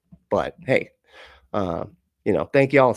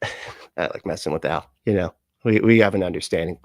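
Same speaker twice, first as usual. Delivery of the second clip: normal talking voice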